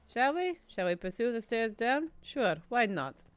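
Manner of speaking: usual speaking voice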